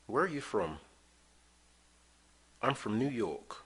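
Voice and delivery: normal talking voice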